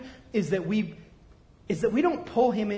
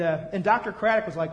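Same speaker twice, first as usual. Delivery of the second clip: usual speaking voice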